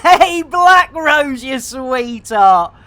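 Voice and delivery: loud, slow affectionate greeting; fond shout-out. Real, not acted. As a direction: call out warmly to a fan.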